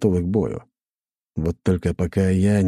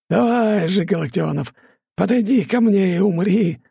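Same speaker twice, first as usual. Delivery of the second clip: high, loud villain cry; mad scientist; 14 semitones higher, 4 dB louder than usual. Acted. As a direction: shriek it like a gloating madman. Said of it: "безумно хохотал". (безумный злодейский визг)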